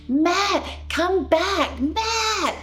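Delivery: high, desperate call to bring him back; screaming. Retold or imitated. Wife pushing at husband's chest, screaming his name. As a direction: cry out as if to break through to him. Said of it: "I'm trying to scream at Matt".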